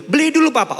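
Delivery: put-on high, loud child's demand; imitated kid begging loudly; insistent little child. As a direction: plead with Papa like a small kid. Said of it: "Anak saya yang paling kecil".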